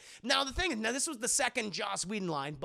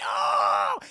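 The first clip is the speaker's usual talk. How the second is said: mock pained cry; 10 dB louder than usual. Logan stabbed in the gut.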